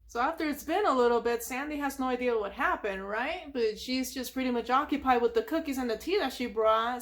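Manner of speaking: normal talking voice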